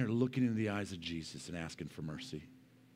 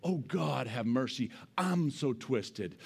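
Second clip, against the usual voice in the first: ordinary talk, nothing marked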